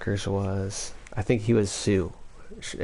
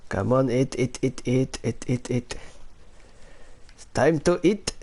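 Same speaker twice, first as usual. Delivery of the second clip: higher-pitched Filipino accent impression; 4 semitones higher than usual. Shown as a demonstration. Telling someone it's time to eat, Filipino accent. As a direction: urge them on in a put-on Filipino accent. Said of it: "Here's my Filipino accent".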